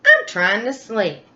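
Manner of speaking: a squeaky woken mouse complaining; grumpy mouse. Acted. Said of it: "Mouse asked".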